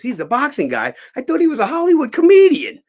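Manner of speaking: loud, rising astonishment; acted-out disbelief. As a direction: climb to a high, loud exclamation. Old actor astonished at the job.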